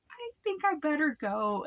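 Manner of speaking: high, anxious voice; nervous retreat. Retold or imitated. Scared boy tells the neighbor he should leave, high anxious voice. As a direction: say it nervously, backing off.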